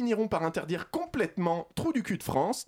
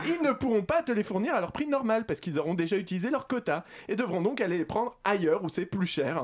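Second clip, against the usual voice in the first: ordinary delivery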